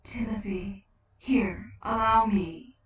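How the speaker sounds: a gentle otherworldly figure offering help; voiced spirit of Death, calm; Death's voice. Death speaks softly to Tim.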